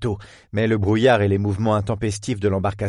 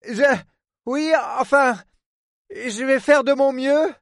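hesitant agreement. Acted. Woman agrees to help the port man, hesitant. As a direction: stumble into saying yes.

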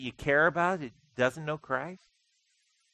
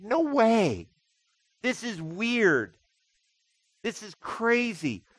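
higher, disbelieving no way, slowing on the last lines; incredulous thought; 7 semitones higher, slower than usual. Retold or imitated. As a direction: act out amazed disbelief. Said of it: "you just think".

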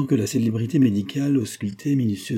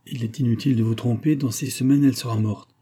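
usual speaking voice

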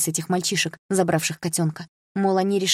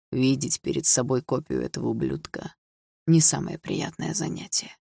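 low sneer; 4 semitones lower than usual. A narrator's reading. Stepfather sneering at his stepdaughter, contemptuous.

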